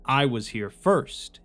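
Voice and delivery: ordinary delivery, nothing marked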